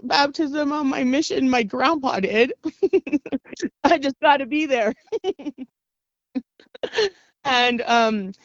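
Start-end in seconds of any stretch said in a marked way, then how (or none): 2.6-3.8 high giggle at your own joke; amused laugh. Real, not acted. 5.0-5.6 soft, high giggle; trailing giggle. Real, not acted. Laughs at own joke.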